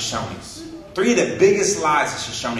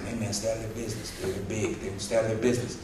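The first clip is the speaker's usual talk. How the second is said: much softer and lower, quick hushed advice; hushed warning; 8 semitones lower, 10 dB quieter, faster than usual. Retold or imitated. To yourself about two brothers talking, a fast hushed warning. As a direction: keep it down, quick, like a nervous aside. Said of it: "You're just like".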